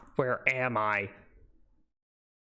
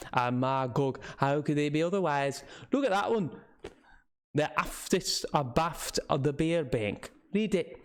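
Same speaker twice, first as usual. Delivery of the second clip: put-on old local man's northern English with Scots touches; rustic northern English, slipping toward Scottish; northern English dialect. Old man scoffing to visitors about warnings, northern English dialect. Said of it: "did not quite understand his dialect".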